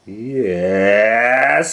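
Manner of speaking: gruff loud beast teacher; gorilla voice. A narrator's reading. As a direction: bark it like a gorilla. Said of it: "said the gorilla".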